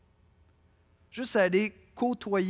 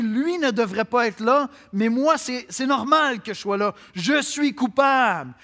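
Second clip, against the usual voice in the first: thief confessing aloud, louder and higher; hint of a repentant thief; 7 semitones higher, 8 dB louder than usual. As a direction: cry out the guilt, slowing on coupable. Preacher voices the repentant thief. (aveu criant du brigand repentant)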